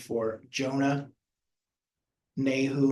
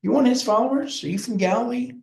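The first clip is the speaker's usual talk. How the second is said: curious high questioning; 9 semitones higher, 3 dB louder, faster than usual. Retold or imitated. Bystanders question Peter by the fire, high and quick. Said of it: "he gets asked three different times".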